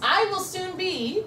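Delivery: slow, high, drawn-out lead-in; powerful affirmation. Shown as a demonstration. Trainer starts fill-in affirmation for consultants, slow and emphatic. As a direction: stretch each word for the room to echo. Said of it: "repeat after me with the same inflection".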